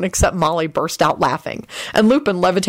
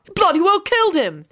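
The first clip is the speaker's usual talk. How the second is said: high-pitched mock-outraged yell; high storytelling yell; 12 semitones higher than usual. A narrator's reading. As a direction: cry it out high, accusing. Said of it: "George yelled out".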